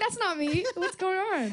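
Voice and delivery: high mock-British voice; British accent. Shown as a demonstration. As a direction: exclaim in a put-on British accent. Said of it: "Can you do a British accent".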